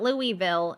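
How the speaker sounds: "Louisville," as LOO-ee-vil, silent S; "Louisville," with a clear EE and no S; rejected pronunciation. Shown as a demonstration. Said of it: "not Louisville".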